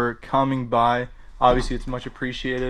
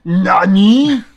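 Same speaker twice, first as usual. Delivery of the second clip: loud, high anime-style shout; hammed-up anime character yelp; anime exclamation; 7 semitones higher, 12 dB louder than usual.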